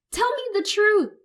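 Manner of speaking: very high, loud squeal. Acted. Son squealing at his mother, tearful. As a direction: squeal it through tears. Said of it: "I squeal".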